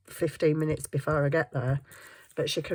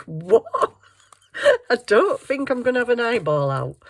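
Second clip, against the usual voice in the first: high, loud disbelieving reply; incredulous; 6 semitones higher, 7 dB louder than usual. Retold or imitated. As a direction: sound shocked at the idea. Shocked reply to friend's joke.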